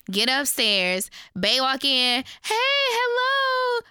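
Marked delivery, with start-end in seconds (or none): 2.4-3.8 very high, chirpy hello; sing-song greeting. Retold or imitated. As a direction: greet her brightly in a high voice. Bae greets her mother.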